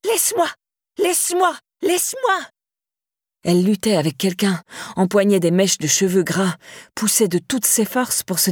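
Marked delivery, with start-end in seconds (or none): 0.0-2.4 very high, desperate screams; spitting screams. A narrator's reading. Laura in her nightmare fighting Daniel, screaming. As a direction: scream it as you fight him off. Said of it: "elle crachait en criant".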